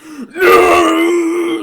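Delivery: long, loud imitated "no"; hammed-up drawn-out wrestler scream; enraged roar. Bonesaw angered by Spidey's taunt, roaring. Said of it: "that angers Bonesaw".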